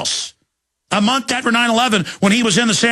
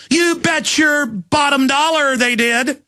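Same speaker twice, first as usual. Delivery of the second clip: higher, slow emphatic declaration; 6 semitones higher, slower than usual. Real, not acted. Radio host backing his guest, slow emphatic declaration. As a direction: drive it home with slow force.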